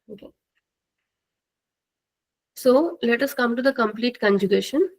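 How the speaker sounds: ordinary talk, nothing marked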